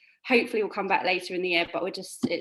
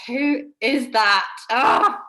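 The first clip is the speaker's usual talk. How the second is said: mock surprised; 4 semitones higher, 7 dB louder, much slower than usual. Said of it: "and I'm like".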